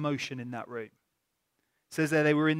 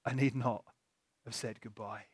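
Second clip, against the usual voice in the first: soft, lower, breathless awe; Mary realizing Jesus is alive, hushed; awed realization; 8 semitones lower, 9 dB quieter than usual. Acted. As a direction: let it dawn on you quietly.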